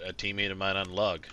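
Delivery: ordinary delivery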